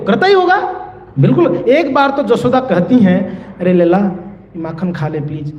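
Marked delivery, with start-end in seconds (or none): 3.6-5.5 coaxing mother. Acted. Yashoda softly coaxing her son to eat the butter. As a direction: lower and softer, pleading with her child.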